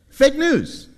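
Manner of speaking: high, loud mock-politician voice; Donald Trump. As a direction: throw out a catchphrase as a presidential impression. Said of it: "my best Donald Trump impression".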